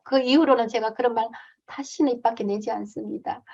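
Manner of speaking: usual speaking voice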